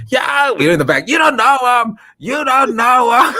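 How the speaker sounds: high, loud jeering; heckling shout from the back; mocking shout. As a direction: yell it to mock him. Friends jeering from background.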